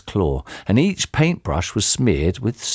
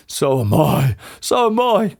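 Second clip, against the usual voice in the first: mildly panicked husband; distressed cry; 2 semitones higher than usual. A narrator's reading. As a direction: agree with a touch of panic. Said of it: "cried Mister Twit".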